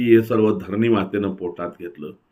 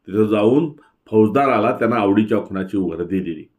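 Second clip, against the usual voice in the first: ordinary delivery, nothing marked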